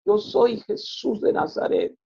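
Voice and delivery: a slow, hushed, high proclamation of Yo soy Jesús de Nazaret; voice of Jesus. Retold or imitated. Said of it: "y me dijo estas palabras". (voz de Jesús, alta, lenta y susurrada)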